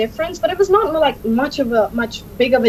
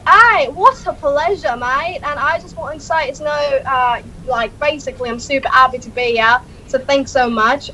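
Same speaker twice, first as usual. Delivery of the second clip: Norfolk accent; 2 semitones higher than usual. Shown as a demonstration. Demonstrating dialects for the host, Norfolk accent. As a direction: say a thank-you in a rural Norfolk accent.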